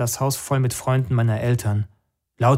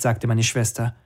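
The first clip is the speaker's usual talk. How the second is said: plain reading voice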